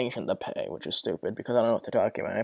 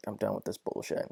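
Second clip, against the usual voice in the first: fed-up mutter; 5 semitones lower, 11 dB quieter than usual. Retold or imitated. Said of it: "'cause I'm like".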